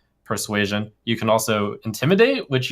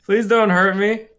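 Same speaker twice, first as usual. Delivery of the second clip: high, pleading skeleton voice; begging for my life; 11 semitones higher, 5 dB louder, slower than usual. Acted. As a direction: beg for mercy in a high squeaky voice. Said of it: "begs for his life".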